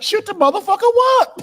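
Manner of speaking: very high, loud shriek; shrieking [name]. Retold or imitated. [name] shrieking in Harlem, very high and loud. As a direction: scream it up in falsetto. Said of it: "I was like".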